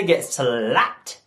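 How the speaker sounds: drawn-out, exaggerated "slapped". Comedian warns listeners of consequences, hammed-up woman's delivery. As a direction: stretch the word with mock sass.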